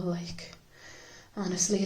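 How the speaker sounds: ordinary talk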